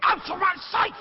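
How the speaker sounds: a king losing his temper; raging shout. Acted. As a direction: scream it high and loud at him. Lear raging at Kent.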